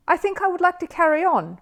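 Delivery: put-on high, earnest young bear; a small bear deciding bravely; little bear voice. A narrator's reading. Paddington telling the host he will carry on.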